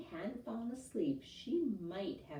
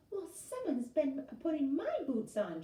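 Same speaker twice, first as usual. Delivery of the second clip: higher, indignant huff; huffing Mama Bear; 9 semitones higher, 4 dB louder than usual. A narrator's reading. Mama Bear checking her fancy boots, indignant huff. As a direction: huff like an offended mother bear. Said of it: "she huffed".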